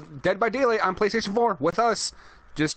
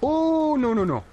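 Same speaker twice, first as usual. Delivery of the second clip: alarmed; 7 semitones higher, slower than usual. Real, not acted. Caught by killer in game.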